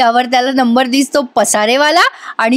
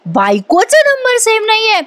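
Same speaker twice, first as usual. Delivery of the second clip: disbelief; 10 semitones higher than usual. Retold or imitated. Wife repeats in disbelief.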